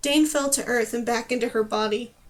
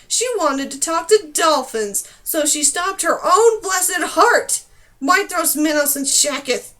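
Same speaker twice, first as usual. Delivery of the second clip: loud, higher outraged rant; outraged shouting; 4 semitones higher, 7 dB louder than usual. Acted. Numer ranting to the clouds about Dane's folly, shouting. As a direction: shout the absurdity to the sky.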